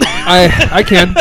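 loud laughing reply. Real, not acted. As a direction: laugh out the words. Asked if able to talk.

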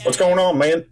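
lightly imitated greeting of a friend; Deuce. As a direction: do a light impression of your buddy's catchphrase. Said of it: "That's my Deuce impression".

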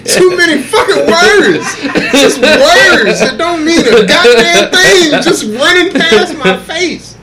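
high, slow, laughing complaint; exasperated laughing rant. Real, not acted. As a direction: rant while cracking up. Laughing rant about words.

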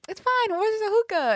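high, airy mock of a carefree guy. Friend mimicking the unbothered Sag at the restaurant, high careless mock.

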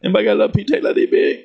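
fast, high, rushed request; mock garbled question. Re-imitating the coworker asking for tape. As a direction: blurt it out all mixed up.

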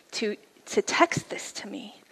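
whispered, touched; moved whisper. Real, not acted. Telling the audience, whispered in wonder. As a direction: let it fall to a whisper.

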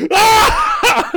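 mock terrified yelp, very high and loud, with a laugh; Justice Smith's yelp. Imitating the actor's scream on the ladder. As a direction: squeak it out wide-eyed. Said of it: "And he's like".

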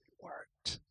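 whispered in disbelief; soft amazed whisper; awed whisper. Acted. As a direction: breathe it out, stunned. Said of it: "opens them and goes".

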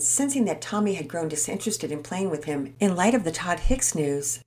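ordinary delivery, nothing marked

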